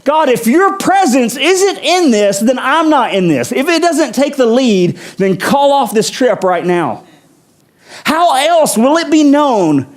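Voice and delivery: impassioned, high-pitched plea to God; Moses. Acted. Praying to God about his presence on the journey, impassioned plea. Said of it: "And Moses said".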